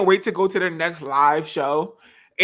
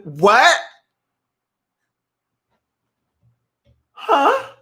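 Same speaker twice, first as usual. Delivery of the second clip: stunned, rising double take; mock-shocked questions; shook; 7 semitones higher than usual. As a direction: react in disbelief with a questioning upswing. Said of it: "I was so shook".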